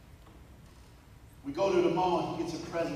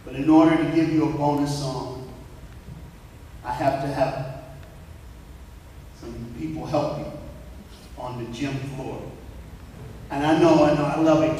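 calling out to the crowd; 2 semitones lower, 8 dB louder than usual. Real, not acted. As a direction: raise the gym's energy with a big loud call. Pumping up the gym crowd.

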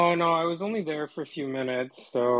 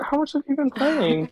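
excited; 10 semitones higher than usual. Real, not acted. Laughing, teasing about gameplay hours.

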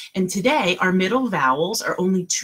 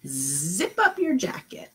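ordinary talk, nothing marked

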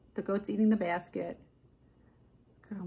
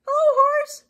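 cheery duck voice; 9 dB louder than usual. A narrator's reading. Duck calling out to Cow as he rides past, cheery duck voice. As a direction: call out high and loud as a little duck. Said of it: "said Duck".

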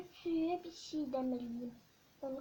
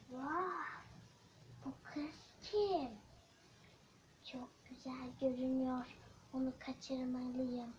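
sneaky villain; 2 semitones lower, 7 dB quieter than usual. Acted. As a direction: plot quietly, like a lurking kidnapper. Villain eyes the girl.

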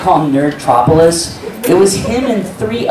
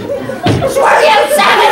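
a high, laughing screw you; mock outburst; much slower than usual. [name] snapping back at Chris in mock outrage. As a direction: fling it out half laughing.

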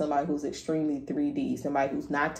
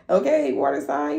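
much higher, cheering them on; hype; 10 semitones higher, 4 dB louder than usual. Real, not acted. Hyping up viewer.

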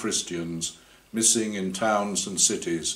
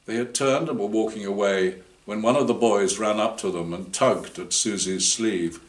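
usual speaking voice

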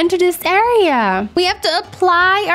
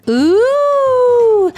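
loud, high ooh of delight; thrilled at the donut; 7 semitones higher, 4 dB louder than usual. Real, not acted. Sees donut in hand.

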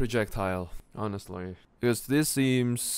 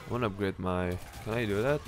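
ordinary talk, nothing marked

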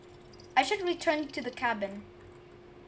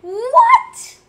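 high, loud shocked "What?"; shocked shout; 11 semitones higher, 13 dB louder than usual. Real, not acted. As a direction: burst out in disbelief. Sees chapter two.

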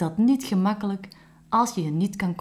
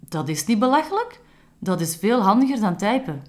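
higher and louder, offended retort; indignant exclamation; 5 semitones higher, 5 dB louder than usual. Acted. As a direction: snap back, defending his habit. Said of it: "riep Camille uit". (Camille roept verontwaardigd, hoger en luider)

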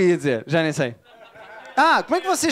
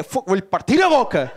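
slow, mock-menacing threat, half laughing; mock Porto threat; 4 dB louder, slower than usual. Porto local threatening the comedian, mock menace. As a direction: growl the threat like a hammy local tough. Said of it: "Ou então é o outro que é".

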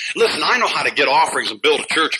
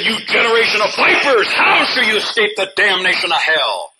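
raised, loud, thundering rebuke; preached rebuke; 6 semitones higher, 4 dB louder than usual. Retold or imitated. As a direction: thunder the quote at the hypocrites. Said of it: "He said".

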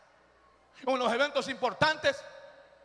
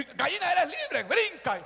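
higher-pitched urging to a hen; 4 semitones higher than usual. Retold or imitated. Boy to the freed hen, urging it on. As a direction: coax the bird to jump.

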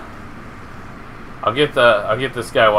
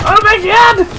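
high, loud, thrilled; thrilled exclamation; 7 dB louder than usual. Real, not acted. Gamer reacting to his chaos, thrilled shout. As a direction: shout your disbelief with joy. Hyped cheer during rampage.

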